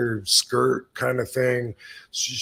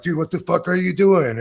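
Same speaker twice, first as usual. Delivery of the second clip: retold incredulous yell; 6 semitones higher, 4 dB louder than usual. Wrestlers egging him on.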